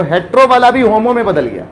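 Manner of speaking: ordinary delivery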